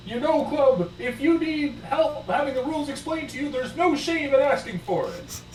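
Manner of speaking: high-pitched, hollered encouragement; top-of-the-voice shout. Acted. Diesel to Club about asking for the rules, top-of-the-voice. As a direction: call it out far too high, for all to hear. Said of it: "at the top of his voice goes".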